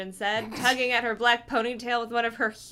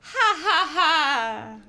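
high spoken ha ha ha; hammed-up fake laugh; mock laugh; 5 semitones higher, 4 dB louder, much slower than usual. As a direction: act out a hearty laugh. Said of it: "her deep-throated laugh".

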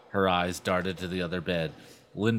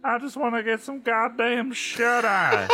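high, drawling, grumpy sister; Lindy, sleepy; 4 dB louder than usual. A narrator's reading. Sister wanting sleep at three, high grumpy voice. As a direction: complain in a high put-on voice. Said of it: "Lindy asked sleepily".

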